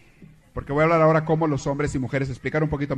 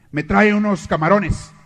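louder, slow, sulky order; grumpy husband; 5 semitones higher, 7 dB louder, slower than usual. Acted. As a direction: bark the order at the waiter.

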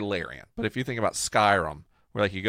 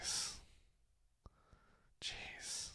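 whispered awe; 21 dB quieter than usual. Real, not acted. Awed at Baldur's Gate.

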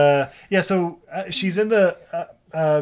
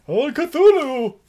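high, drawn-out mock invocation; mock cultist cry; 10 semitones higher, 4 dB louder, much slower than usual. Podcaster capping the Lovecraft joke, mock cultist cry. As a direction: call up an elder god, high and slow.

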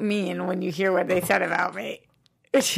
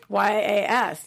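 spelling it out; much slower than usual. Shown as a demonstration. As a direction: spell out Y A S letter by letter. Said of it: "Spell it for me".

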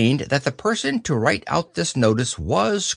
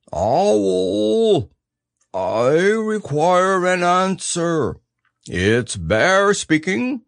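high, drawn-out calling voice; loud call; 6 semitones higher, much slower than usual. A narrator's reading. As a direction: call out slowly and importantly for someone to answer. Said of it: "in a very loud voice".

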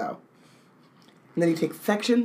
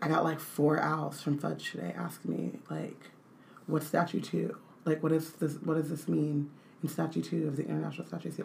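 softer gossiping Dumbledore, lightly voiced; 3 semitones lower, 11 dB quieter than usual. Dumbledore complaining to McGonagall over tea, soft gossip. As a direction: hush it like sharing tea-time gossip. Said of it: "Dumbledore been like".

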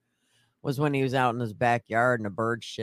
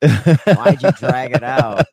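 laughing; 13 dB louder, slower than usual. Real, not acted. Laughing exasperated question.

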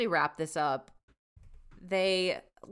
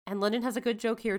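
ordinary talk

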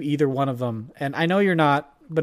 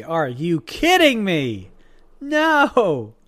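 incredulous objection; 2 semitones higher, 4 dB louder, much slower than usual. Real, not acted. Disagreeing with friend about pets in clothing, incredulous. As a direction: protest in exaggerated disbelief.

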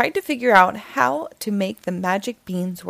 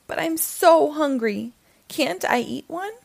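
put-on higher, pleading boy's voice; Jack's protest; 7 semitones higher than usual. Jack pleading with the narrator, higher boy voice.

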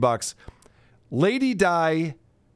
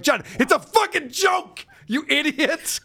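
exasperated yell; 11 semitones higher than usual. Retold or imitated. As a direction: yell at him like he's hopeless. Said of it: "I'm like".

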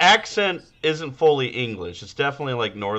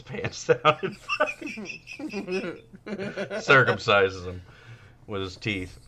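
normal talking voice

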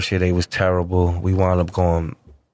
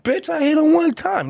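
defiant. Retold or imitated. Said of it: "I was like".

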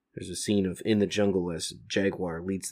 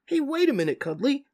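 high, urgent call; alarmed Leo; 4 dB louder than usual. Acted. Leo calls after the cow in a high, urgent voice. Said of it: "says Leo".

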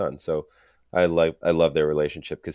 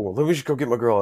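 lightly voiced pleading Drama, higher; a hint of Drama begging to stay; bargaining Drama; 6 semitones higher, 3 dB louder, faster than usual. Drama, kicked out of the hotel, bargaining mildly. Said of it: "like, bargaining".

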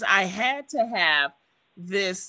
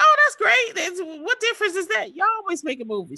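much higher, louder, fast laughing brush-off; laughing dismissive reply; 11 semitones higher, 4 dB louder, much faster than usual. Retold or imitated. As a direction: toss it off with a laugh, like it's old news. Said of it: "And I was like".

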